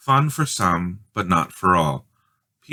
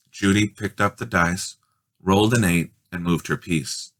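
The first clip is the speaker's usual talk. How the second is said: plain reading voice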